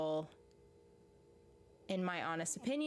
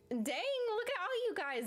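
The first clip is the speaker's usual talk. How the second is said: surprised; 12 semitones higher than usual. Real, not acted. Surprised at viewer count.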